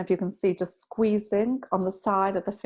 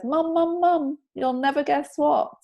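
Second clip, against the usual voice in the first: excited daughter; 7 semitones higher, 3 dB louder than usual. Retold or imitated. Daughter excitedly calling her mother.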